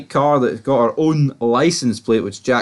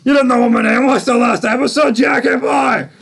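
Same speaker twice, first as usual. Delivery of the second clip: high-pitched hammed-up yell; mock shouting; 11 semitones higher, 3 dB louder than usual. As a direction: shout it high, playing out the showdown. Mocking exchange acted at gunpoint.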